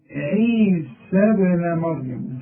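عيسى ابن مريم said slowly with the final ya dropped; connected recitation. Shown as a demonstration. Teacher showing the connected reading of the name. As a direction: drop the long ya of عيسى before ابن. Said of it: "الياء بتاعت موسى اتلغت عند الوصل".

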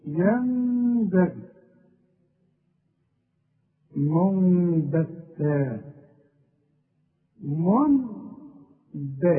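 ينبغي and منبتا with slow hummed meem; iqlab ghunna. Shown as a demonstration. Teacher recites examples for students, very slow ghunna. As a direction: drag the nasal hum very slowly. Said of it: "شوف".

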